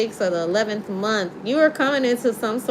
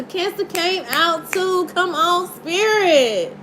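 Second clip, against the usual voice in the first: ordinary delivery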